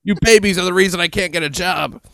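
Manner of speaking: high, put-on voice of a ranter; mock attacker voice. Host parodies the attacker's quote for co-host, mock rant.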